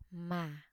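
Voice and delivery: "mà" low and sinking gently; "mà" in the low Vietnamese huyền tone; low falling tone. Shown as a demonstration. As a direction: let "mà" sag downward, softly. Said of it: "Low falling tone or thanh huyền".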